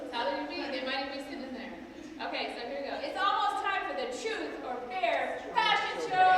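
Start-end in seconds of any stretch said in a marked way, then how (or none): 3.0-6.3 loud, high, upbeat host call; energetic announcement. Acted. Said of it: "I'm gonna deliver Stacy's line in energy".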